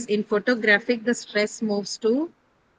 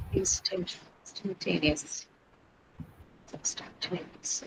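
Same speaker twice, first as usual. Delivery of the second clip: whispering to herself; 2 semitones lower, 11 dB quieter than usual. Real, not acted. Teacher checks word to herself.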